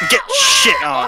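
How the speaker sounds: high, slow mocking cry; gloating shout. Real, not acted. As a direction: jeer it out triumphantly. Gloating after deleting a user.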